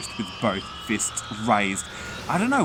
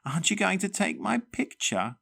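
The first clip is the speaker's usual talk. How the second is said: high, strange demanding granny voice; the ghost insists on attention; high, bossy; 11 semitones higher than usual. Acted. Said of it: "Granny Diva demanded".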